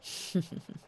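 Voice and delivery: hushed, breath-only chuckle; gloating chuckle. Acted. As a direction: chuckle darkly under your breath. Gloating over those left behind.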